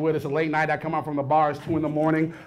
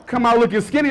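ordinary talk

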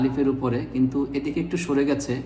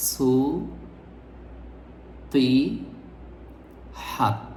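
"সু, তি, হাত" with a long pause after each syllable; 4 dB quieter, much slower than usual. Shown as a demonstration. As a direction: break "সু, তি, হাত" into three separate pieces. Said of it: "শব্দটির সঠিক উচ্চারণ হবে". ("সু, তি, হাত" ভেঙে ভেঙে, থেমে থেমে)

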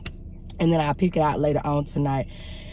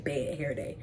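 soft secretive whisper; 4 semitones lower, 12 dB quieter than usual. Real, not acted. Repeats phrase in a whisper.

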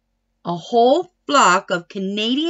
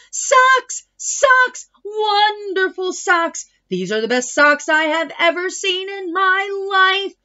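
excited yelling; 7 semitones higher than usual. A narrator's reading. Tina yelling home about her new socks, excited shout. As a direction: yell it out in delight. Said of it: "Tina ran home and yelled".

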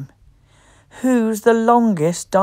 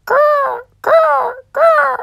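much higher squeaky little growls; pterodactyl growl; much slower than usual. Acted. Reading to children, high squeaky pterodactyl growls. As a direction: growl like a tiny flying reptile.

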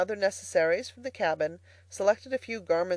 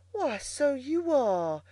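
high, slow, drawn-out discovery; astonished cry; 7 semitones higher, slower than usual. A narrator's reading. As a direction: cry out as the truth dawns, pitched up. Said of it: "she cried".

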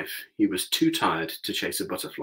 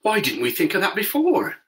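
excited; 11 semitones higher, 4 dB louder than usual. A narrator's reading. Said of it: "cried the Scarecrow".

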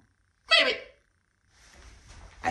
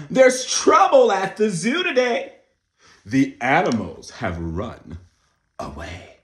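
loud, high dramatic read-aloud; excited read-aloud; storybook reading; 7 semitones higher, 13 dB louder, slower than usual. A narrator's reading. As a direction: announce it like big news to kids. Reading the picture book aloud.

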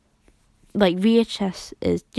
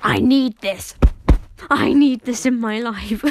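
louder, hammed-up craving; mock desperate want; 3 semitones higher, 7 dB louder than usual. Recalling first seeing the compass, mock-desperate louder want. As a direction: half-shout the need for it. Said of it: "I was like".

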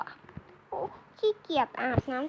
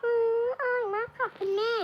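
put-on much higher, sugary little girl voice; cutesy child; 6 semitones higher than usual. Daughter telling mum the sushi is delicious, cutesy voice. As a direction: coo it like a delighted small daughter.

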